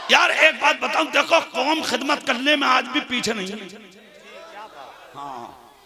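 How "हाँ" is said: normal talking voice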